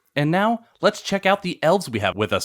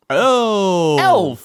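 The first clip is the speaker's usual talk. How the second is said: loud high hype announcer; monster truck ad; 7 semitones higher, 6 dB louder than usual. Acted. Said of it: "monster truck rally style voice".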